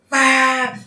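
high, loud horn honk; imitated horn blast. Horn note wakes George Bush, loud imitated blast. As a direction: blare it out like a horn. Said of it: "we hear a loud horn note just blast".

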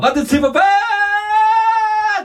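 very high, drawn-out shouted impression. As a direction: imitate a ska-punk singer's stage cry. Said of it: "ああいう動きとかだって". (甲高く伸ばして叫ぶ物まね)

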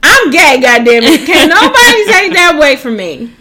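hammed-up protest of pride; mock outraged declaration. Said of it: "she said her deep voice".

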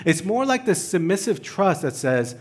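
normal talking voice

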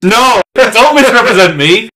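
loud, high-pitched objection; mock-indignant protest. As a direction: burst out in comic indignation. Mock protest at co-host's request.

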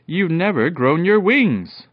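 high, loud, drawn-out delight; joyful howl. A narrator's reading. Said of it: "gave a howl of joy".

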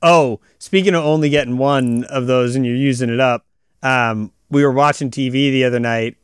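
normal talking voice

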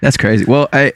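usual speaking voice

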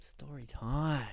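slow, hushed whisper; drawn-out stage whisper; whispered announcement. Pretend. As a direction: breathe it out like a secret. Announcing a story segment.

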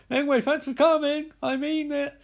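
high-pitched acted announcement; mock hosting. Reviewer playing an activist thanking guests, high mock-hosting voice. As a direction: pitch it way up, addressing the room. Mocking earnest host addressing the group.

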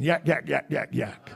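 mock devil chatter. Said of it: "he's just whispering".

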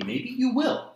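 high, loud sarcastic rise; sarcastic yelp of maybe; mock concession. Real, not acted. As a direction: toss it up in mock doubt. Challenging friend, mocking.

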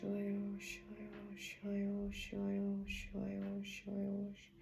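soft, whispered ritual chant; healing prayer. Acted. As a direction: murmur the blessing over her. (tiché šeptané zaříkávání)

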